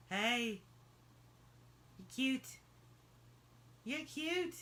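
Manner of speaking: high, soft pet talk; cooing to the dog. Real, not acted. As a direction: coo it to a pet.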